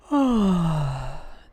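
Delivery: long relaxed yawn-sigh. Shown as a demonstration. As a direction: let out a slow yawn. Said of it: "But when you're yawning".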